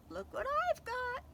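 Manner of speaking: high teasing shout; captain lures the cat with a package; teasing call. Acted. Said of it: "he shouted".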